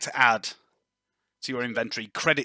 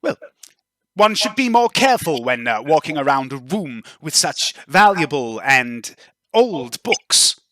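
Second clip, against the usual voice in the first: disapproving noblewoman; 2 semitones higher, 4 dB louder than usual. Acted. Noblewoman rebuking a clumsy guest over old books, disapproving. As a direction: scold with drawn-out, pointed disdain. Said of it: "she seems to glare at you. She's not very impressed".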